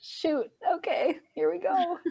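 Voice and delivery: mock steeling herself for hard inner work; resigned determination. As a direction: brace yourself with a sigh of resolve. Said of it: "you're like".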